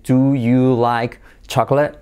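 slow "Do... you... like... chocolate", each word apart; careful, unlinked word-by-word question; separate words. Shown as a demonstration. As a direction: leave gaps between "Do", "you", "like", "chocolate". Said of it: "pero el nativo no dice así".

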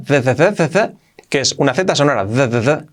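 repeated z buzzed with voice; voiced z for th. Shown as a demonstration. Teacher showing how that starts. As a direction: buzz the z for that. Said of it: "la pronunciación con la T H".

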